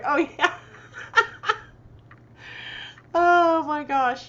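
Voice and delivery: loud laughing, high-pitched. Real, not acted. Reacting to a viewer's funny comment, laughing loudly. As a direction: laugh through the words.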